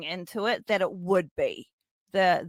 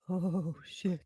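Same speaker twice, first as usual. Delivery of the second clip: drawn-out, much softer reaction; hushed dread; 13 dB quieter than usual. Retold or imitated. Fan retelling the reveal of the buckets, hushed dread. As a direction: let it out under your breath, softly.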